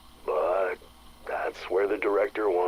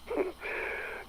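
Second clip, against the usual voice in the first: a short breathy laugh under the breath; soft rueful laugh; 8 dB quieter than usual. Acted. Security chief remembering his granddad's warning, quiet rueful laugh. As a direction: huff out a quiet, rueful chuckle.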